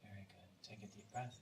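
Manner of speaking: hushed guidance with long pauses between phrases; guided meditation. Real, not acted. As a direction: guide them gently, barely above a murmur. Leads partners through meditation.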